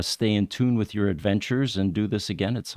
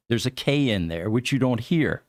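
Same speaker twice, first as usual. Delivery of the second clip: ordinary talk, nothing marked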